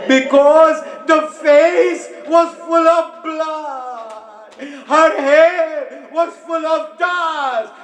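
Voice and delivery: slow, high keening narration; wailing lament. Acted. Preacher to mourners, slow high wail. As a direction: draw out each horror.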